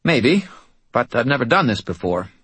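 the medium, focused and tense; tense medium's voice. Acted. Mort, unsure.

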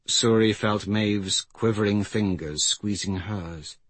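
usual speaking voice

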